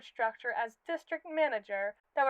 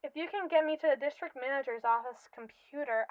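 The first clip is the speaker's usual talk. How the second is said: ordinary talk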